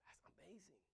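whispered in wonder; hushed amazement; awed whisper. Real, not acted. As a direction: breathe it out in awe. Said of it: "whispered wonder".

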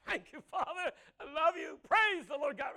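high, loud laughing praise shout; mock joyful praise. Husband thanking God for taking the nagging wife, gleeful shout. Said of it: "if she was a nagging woman".

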